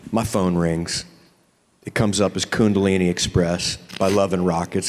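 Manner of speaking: normal talking voice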